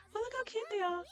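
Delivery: squeaky adoring coo. Real, not acted. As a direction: gush over them like cute puppies. Cooing over the little campers.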